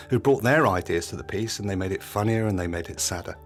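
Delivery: ordinary delivery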